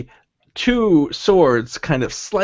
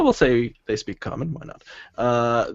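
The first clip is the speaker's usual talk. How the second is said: usual speaking voice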